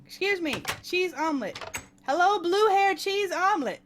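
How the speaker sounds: high, imitated waitress call. Waitress calling customers from behind the counter, high mock holler. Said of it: "she was calling us from the".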